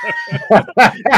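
loud laugh while retelling the son's fear of coyotes; loud burst of laughter. Real, not acted. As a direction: crack up loudly at your own story.

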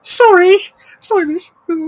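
high squeaky apology; squeaky mock apology. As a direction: pipe it up like a little girl.

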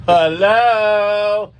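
a high, put-on hello from an older lady; a lightly voiced mama's greeting; mock mama hello; Mama Joyce voice. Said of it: "in my Mama Joyce voice".

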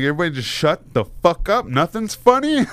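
high, exasperated retold order; trying to be serious. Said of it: "I'm trying to be so serious".

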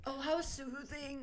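soothed. Real, not acted. Admiring the lava lamp.

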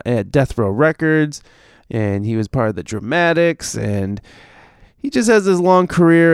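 normal talking voice

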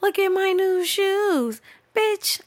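high, drawn-out show-off mimicry; slow squeaky parody of someone showing off; mock it in a high singsong; attention seeker. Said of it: "the bitches who, like, post shit like".

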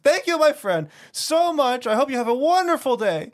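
high, loud, over-the-top friendly send-off; cashier yelling. Retold or imitated. Cashier thanking customer for the card, imitated exuberant yell. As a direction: boom out gushing thanks like a showman. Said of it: "he was, like, yelling".

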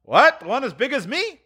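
high, questioning lad; boy's voice. Acted. Boy asking Scrooge about the turkey, high boyish voice. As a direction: ask as an amazed boy. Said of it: "returned the boy".

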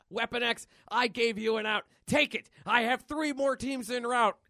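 high, loud radio orders; stern commander. Acted. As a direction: bark an ultimatum over the radio. Commander's radio threat to Wolverine.

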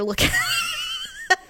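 words breaking up into laughing; helpless laughter. Real, not acted. Mother admits showing daughter dead squirrel, laughing. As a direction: crack up while confessing, barely getting it out. Cracks up confessing to co-host.

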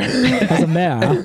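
hammed-up Aussie drawl on "mayor"; laughing Australian impression; Australian accent. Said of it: "I'm doing my Australian accent".

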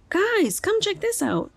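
put-on high, excited kid's call; Henry calling out. A narrator's reading. Henry calls friends from behind the shed, high excited. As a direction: wave your friends over, thrilled. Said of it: "says Henry".

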